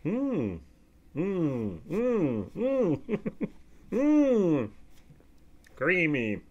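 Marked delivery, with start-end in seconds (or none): 0.0-4.7 soft, repeated falling "mm" of pleasure; savouring hums. Real, not acted. Taster going back to batch three, savouring hums. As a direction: hum with delight, a little chuckle between.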